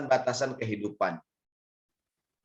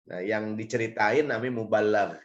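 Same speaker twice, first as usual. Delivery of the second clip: ordinary delivery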